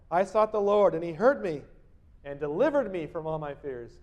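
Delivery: loud proclaimed psalm; scripture reading. Acted. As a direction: read it out as a promise. Preacher reads psalm aloud.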